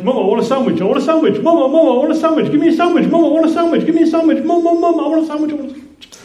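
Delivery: put-on high, loud whiny child pestering; nagging child. Child nagging mum for a sandwich, whiny and loud. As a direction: pester over and over like a demanding kid.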